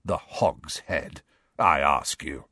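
low, slow, amused disbelief; grinning mockery. A narrator's reading. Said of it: "grinning still more broadly".

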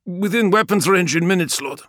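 an officer shouting damage over battle; shipmaster. Acted. Shipmaster reports.

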